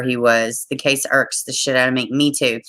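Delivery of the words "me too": ordinary talk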